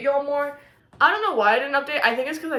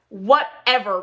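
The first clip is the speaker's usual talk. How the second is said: normal talking voice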